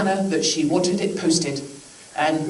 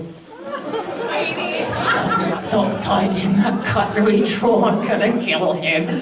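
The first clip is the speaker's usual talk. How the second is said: venting; slower than usual. Acted. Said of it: "sorry, just getting that off my chest".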